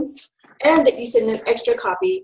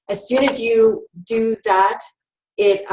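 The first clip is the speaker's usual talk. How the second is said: ordinary talk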